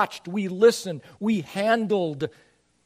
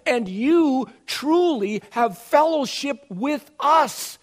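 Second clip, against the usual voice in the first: "you" and "truly" pressed, higher, slow and louder; stress on you; 7 semitones higher, 7 dB louder, slower than usual. Shown as a demonstration. Pastor insisting to listeners that "you" share apostles' fellowship. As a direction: lean hard on "you", slow it right down. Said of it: "The emphasis is actually to his listeners".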